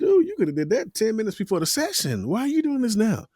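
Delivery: high-pitched imitated scolding; mock exasperation. Crew scolds unprepared talent.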